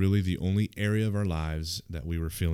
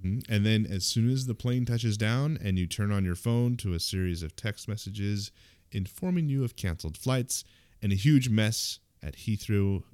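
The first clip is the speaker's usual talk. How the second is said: ordinary delivery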